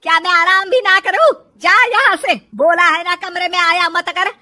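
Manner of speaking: loud, rising scolding; irate mother-in-law. Acted. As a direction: order her out of the room angrily. Mother-in-law snaps at daughter-in-law.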